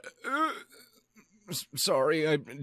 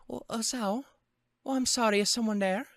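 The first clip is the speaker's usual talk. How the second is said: high-pitched Scottish lady's voice; Scottish accent attempt; 10 semitones higher than usual. Lily asking who is there, high Scottish accent. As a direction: try out a high Scottish lilt. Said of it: "done a Scottish accent".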